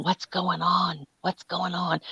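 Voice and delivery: hushed, slowed nosy question, husband imitated; lightly whispered gossip hunger; quiet mock nosy ask. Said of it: "He's all the time going".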